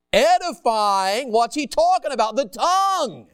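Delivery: drawn out, rising to a loud, high finish; emphatic preaching. Real, not acted. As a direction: hammer it home to the congregation. Preacher builds emphatic point to congregation.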